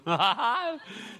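high-pitched laugh; amused laugh. Real, not acted. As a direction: laugh at your own impression. Laughs after voicing mom.

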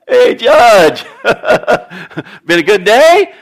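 loud, bright needling call to the judge; cheery pestering widow, loud. Acted. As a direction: hail him like a nagging widow. Widow voiced nagging the judge.

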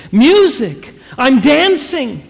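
high, elated outburst; delighted exclamation. A narrator's reading. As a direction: cry out with joy at the dancing. Wife exclaims that she is dancing.

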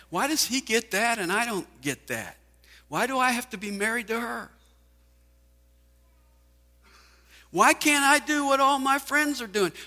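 whiny complainer. Retold or imitated. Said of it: "Do you complain all the time?".

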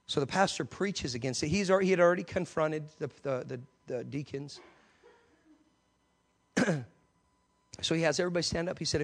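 ordinary delivery

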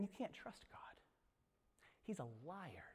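whispered, conspiratorial; tempter's whisper. Acted. Serpent whispering lies to Eve, hushed whisper. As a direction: breathe it into her ear.

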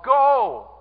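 loud, high, drawn-out shout; emphatic command. Retold or imitated. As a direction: call it out as an order. Quotes Jesus's command emphatically.

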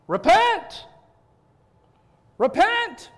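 high, loud preacher yell; yelling repent. Retold or imitated. John the Baptist calling the crowd, loud prophet yell. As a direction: bellow it like a wild prophet. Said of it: "And he would yell it".

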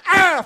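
short high shriek of fright; a sharp squeal as the car thumps; retold scream.

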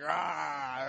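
drawn-out playful monster roar; Hulk roar. Acted. Preacher showing the fun of ripping clothes, Hulk roar. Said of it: "be like".

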